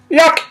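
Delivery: high, disgusted yuck; kitty's yuck. Acted. Kitty rejecting the food, high squealed yuck. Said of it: "says the kitty".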